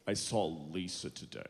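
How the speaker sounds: low, lazy dude voice; slack-lipped guy. Retold or imitated. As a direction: imitate a guy with no effort in his speech. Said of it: "that's how he said it".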